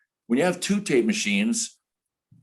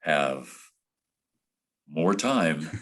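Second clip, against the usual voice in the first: drawn-out words between long silences; veteran broadcaster's deliberate delivery; 5 semitones lower, slower than usual. Retold or imitated. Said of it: "that's how he delivered it".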